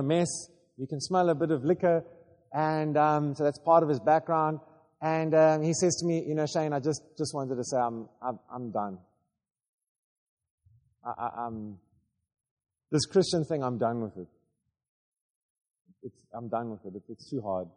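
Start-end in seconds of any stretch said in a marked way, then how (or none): 6.3-17.7 low, soft and worn out, trailing away; weary quiet resignation. Retold or imitated. Tired parishioner quietly telling the pastor he quits. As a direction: sound like a man who has given up. Worn-out man quitting his faith.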